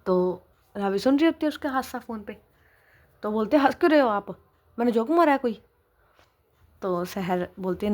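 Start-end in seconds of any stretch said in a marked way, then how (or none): 3.6-5.6 higher and louder, offended; indignant at being laughed at; annoyed demand. Acted. Wife annoyed that husband laughs at her, offended demand.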